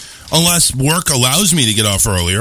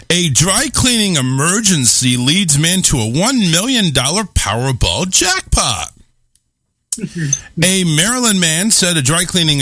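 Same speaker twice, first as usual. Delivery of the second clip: news read; 4 semitones higher, slower than usual. Acted. Reads news headline aloud.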